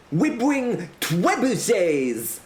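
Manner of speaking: put-on high, slow accented threat; irate foreign herald. Herald warns of siege engines, high indignant proclamation. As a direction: announce it in high comic menace.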